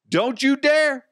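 high-pitched exclaimed warning; mock outcry. Host warning listeners off the bed, mock outcry. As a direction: cry it out high, as a mock scolding.

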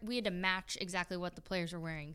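ordinary talk

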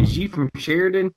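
high, teasing South Arkansas twang; rural Arkansas accent. Acted. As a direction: tease in a country Arkansas accent. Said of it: "You sound like you're from my neck".

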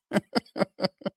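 a short high laugh, tickled by his story; amused laugh. Real, not acted. Host laughing at his own bet story, a short high laugh.

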